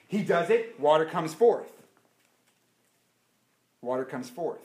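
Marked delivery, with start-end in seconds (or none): 3.8-4.6 much softer, lower repeat; hushed echo. Acted. Preacher repeating softly that water comes forth. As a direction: let it sink in quietly.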